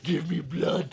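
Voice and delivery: slow, strained moaning plea for blood; mock vampire craving. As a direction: groan it like a starving monster. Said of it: "He's on the floor going".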